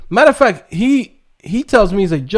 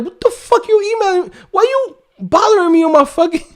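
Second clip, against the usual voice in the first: much higher put-on voice, baffled and annoyed; Jeff Bezos annoyed; 12 semitones higher than usual. Billionaire mid-interview mocking a fan's email, high annoyed voice. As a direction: play the billionaire baffled by a pestering fan. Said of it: "Jeff Bezos looking at this dude like".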